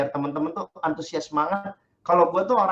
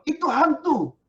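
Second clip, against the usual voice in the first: higher, startled cry of ghost; mild frightened shout; 9 semitones higher, slower than usual. Acted. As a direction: cry out as if you've seen a ghost. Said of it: "mereka terkejut dan berseru".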